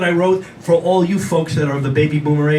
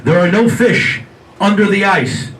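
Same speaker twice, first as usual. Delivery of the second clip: slow, louder booming voice from nowhere; mysterious voice again; 3 semitones higher, 5 dB louder, slower than usual. Retold or imitated. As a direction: stretch it out like a voice from above. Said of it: "He hears the voice again".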